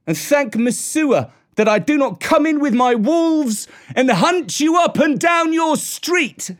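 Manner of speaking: high, declaimed defiant speech; scornful shout. A narrator's reading. Mowgli bids the villagers farewell, shouting scornfully. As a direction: proclaim it loudly with contempt. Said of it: "shouted Mowgli scornfully".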